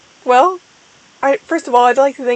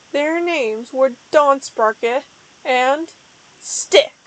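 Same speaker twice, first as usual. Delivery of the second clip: usual speaking voice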